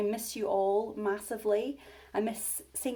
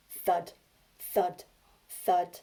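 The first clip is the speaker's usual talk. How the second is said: heavy footsteps; 3 semitones lower, 4 dB louder, much slower than usual. Acted. Giant footsteps sound effect.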